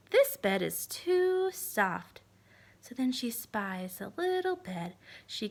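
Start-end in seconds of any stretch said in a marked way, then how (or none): 0.1-2.2 very slow, drawn-out complaint; Goldilocks. Acted. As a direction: stretch every word. Goldilocks tests beds.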